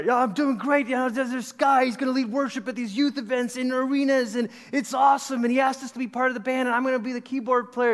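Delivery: high-pitched, eager rush of news; excited student phoning dad with big plans; excited retold pitch.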